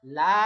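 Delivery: লা held long and loud as "laaa"; loud, steady, stretched লা; long madd vowel. Shown as a demonstration. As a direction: draw out the "aa" of লা. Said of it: "এটা হলো মাদ".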